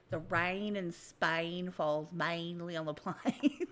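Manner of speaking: slow, lightly posh English elocution with a laugh; elocution line. Retold or imitated. A hint of posh English quoting My Fair Lady again. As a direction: savour each vowel, slightly posh English. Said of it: "when she started".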